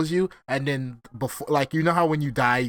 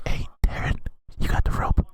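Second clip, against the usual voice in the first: urgent stage whisper; forceful, breath-only whisper to an accomplice; conspiratorial whisper; 3 dB louder than usual. Pretend. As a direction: whisper it like a secret plot. Plotting secretly against the intruder.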